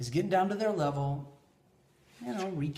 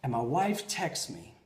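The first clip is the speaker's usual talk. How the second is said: usual speaking voice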